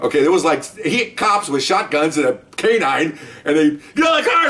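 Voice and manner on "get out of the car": high-pitched retold police scream; frantic high mock yell. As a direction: imitate cops screaming orders, voice way up. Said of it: "They're screaming".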